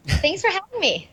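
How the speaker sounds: ordinary talk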